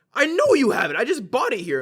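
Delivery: hammed-up loud indignation; imitated irritated retort. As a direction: snap back as the impatient old man. Customer snaps back at clerk.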